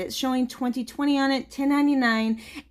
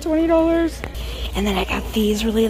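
normal talking voice

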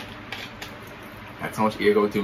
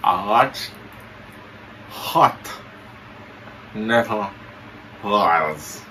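talking with mouth held open; words through a propped-open mouth; 3 semitones lower, 5 dB quieter, slower than usual. Pretend. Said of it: "you say you're not pronounce good".

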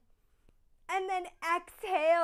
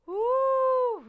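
high whoop of relief; 10 semitones higher, 8 dB louder than usual. Real, not acted. Release of effort after set.

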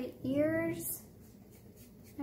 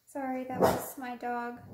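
normal talking voice

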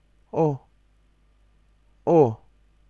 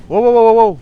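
higher, louder amazed whoops; excited wow; 6 semitones higher, 6 dB louder than usual. Real, not acted. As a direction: cry out in wonder. Stars light the path. (ตื่นเต้น ร้อง โว้ว เสียงสูงขึ้น)

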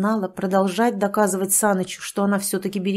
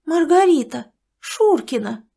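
higher, louder astonished cry; shocked; 8 semitones higher, 5 dB louder than usual. Acted. Galina gasps at the news, higher and shocked. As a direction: gasp out the name.

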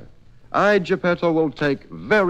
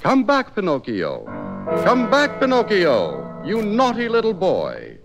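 calling out; 7 semitones higher than usual. Acted. Geppetto calling after runaway puppet.

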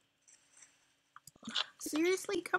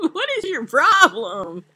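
high, loud, laughing protest; amused outrage; 6 semitones higher, 8 dB louder than usual. Real, not acted. Player to sassing teammate, amused outrage. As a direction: demand it in high, amused disbelief. Laughing retort to mock scolding.

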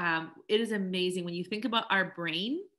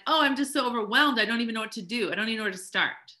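overwhelmed whine; 3 semitones higher, 6 dB louder, faster than usual. Pretend. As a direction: play up the helpless overwhelm. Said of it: "And we're like".

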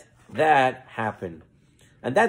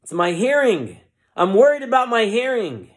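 worried insistence; 7 semitones higher, 4 dB louder than usual. Acted. Sam repeats his worry to preacher.

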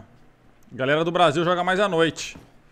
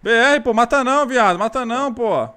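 loud, higher urgent pleading; 5 semitones higher, 4 dB louder, slower than usual. Real, not acted. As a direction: beg him loudly to hold fire. Begging fellow Brazilian not to kill.

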